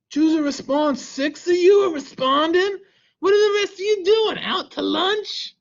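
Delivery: high, playful put-on voice; put-on comic character. Teacher prodding students who aren't responding, high comic voice. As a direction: tease them in a high, silly character voice.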